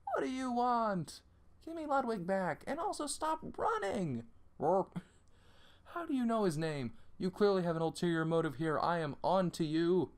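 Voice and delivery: a lightly voiced paranoid escapee; suspicious runaway.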